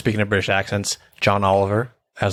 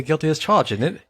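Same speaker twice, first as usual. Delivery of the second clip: mock British accent; 6 semitones higher than usual. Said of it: "They say".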